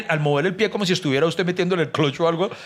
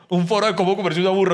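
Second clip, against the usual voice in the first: ordinary delivery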